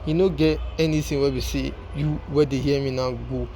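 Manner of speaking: normal talking voice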